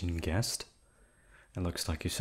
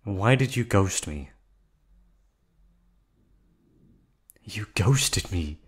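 accusing; 5 dB louder, slower than usual. Acted. Accuses captive of ghosting.

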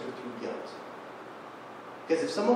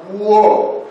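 louder, higher shocked exclamation; loud startled whoa; 6 semitones higher, 15 dB louder than usual. Real, not acted. Preacher recoiling from inner accusations, loud startled whoa.

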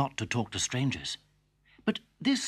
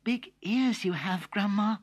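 put-on wondering little girl; 9 semitones higher, slower than usual. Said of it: "girl to wolf".

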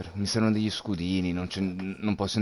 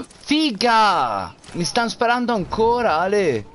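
being chased; 12 semitones higher, 7 dB louder, slower than usual. Real, not acted. Under fire, calling to teammate.

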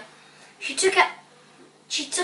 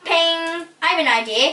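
raised, loud, bright call; sudden bright enthusiasm; brightly; 7 semitones higher, 5 dB louder than usual. A narrator's reading. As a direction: pipe up eagerly with an idea.

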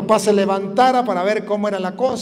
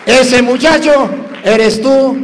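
loud, fervent preaching, pushed high; preaching at full force; 4 semitones higher, 6 dB louder than usual. Acted. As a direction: drive the lesson home to the congregation. Preaches the moral loudly. (predicando a todo pulmón)

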